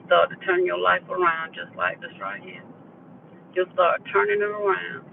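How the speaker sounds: ordinary talk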